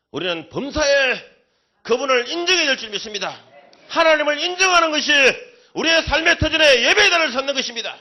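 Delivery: loud, high preacher's exhortation; fiery preaching. Acted. Pastor exhorting the congregation, loud fiery preaching. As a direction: drive it home to the congregation with full force.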